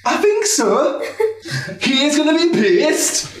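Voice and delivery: high, giggling Valkyrie voice. Acted. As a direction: panicky warrior maiden voice, laughing through it. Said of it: "says the second Valkyrie".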